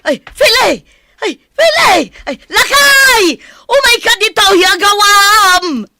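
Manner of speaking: frantic high shout for help; panicked shouting across the house; calling out. Acted. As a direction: yell for husband, far and urgent. Mother calling out in alarm.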